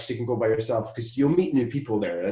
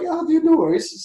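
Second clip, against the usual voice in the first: much higher, casual bluff; breezy fake reassurance; 5 dB louder than usual. Telling the organiser no worries, breezy bluff. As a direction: toss it off like it's nothing. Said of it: "So I was like".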